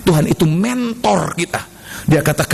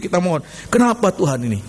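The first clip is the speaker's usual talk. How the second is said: ordinary talk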